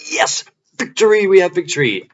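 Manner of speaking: high, loud victory cry; triumphant cheer. Retold or imitated. Celebrating getting the watch back, high triumphant cheer. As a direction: cheer it out high, reliving the win. Retold celebration after escaping.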